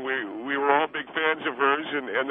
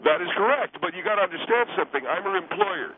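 high, fast defensive protest; 12 semitones higher than usual. Acted. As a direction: shoot back high and defensive. Defensive outburst.

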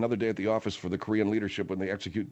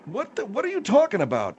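exasperated; 10 semitones higher than usual. Real, not acted. Host baffled by guest's rant.

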